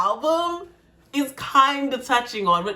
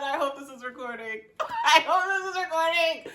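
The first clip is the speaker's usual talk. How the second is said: laughing; 3 semitones higher, 4 dB quieter than usual. Real, not acted. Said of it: "laughing while emotional".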